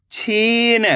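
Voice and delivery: ordinary talk, nothing marked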